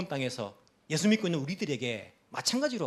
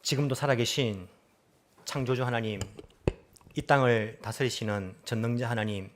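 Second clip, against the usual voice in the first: low, measured public prayer; 6 semitones lower than usual. Real, not acted. Pastor to God on behalf of the congregation, low earnest prayer. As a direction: pray solemnly in a lowered, even voice.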